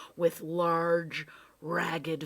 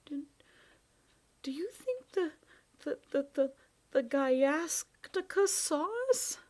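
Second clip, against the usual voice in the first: higher, stammering, frightened voice; shaken stammer; 10 semitones higher than usual. Acted. Shaken boy asking the professor, frightened stammer. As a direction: stumble over the words, still scared. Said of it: "Ben was badly shaken".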